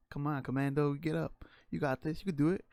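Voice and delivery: imitating brother's loud pep talk. Brother urging him up: mock loud pep talk. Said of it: "he'd call me a commando".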